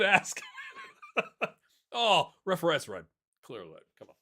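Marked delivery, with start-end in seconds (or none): none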